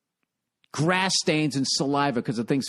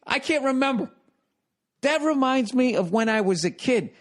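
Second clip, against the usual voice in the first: ordinary talk, nothing marked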